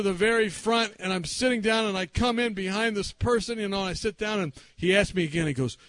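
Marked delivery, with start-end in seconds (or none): none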